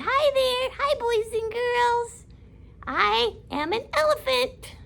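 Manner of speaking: toy elephant voice. Pretend. Stuffed elephant greeting the boys and girls, high cartoon voice. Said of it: "My little fuzzy elephant".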